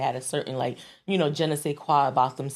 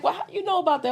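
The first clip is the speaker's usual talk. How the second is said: much higher-pitched mock query; imitated puzzled questioner; 14 semitones higher, much faster than usual. People question her Southern habits, imitated high-pitched puzzlement. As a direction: quote a nosy questioner up high.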